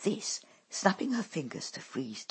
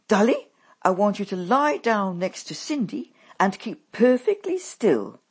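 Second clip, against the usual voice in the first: bossy mother; 3 semitones higher, 9 dB louder than usual. A narrator's reading. Mother orders her daughters.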